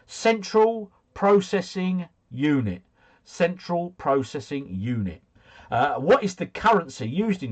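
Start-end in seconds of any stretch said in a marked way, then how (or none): 0.1-2.8 slow, high, each word laid out; slow spelled-out answer. Shown as a demonstration. Quiz host reading the answer to viewers, slow and deliberate. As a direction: give it slowly like reading the answer key.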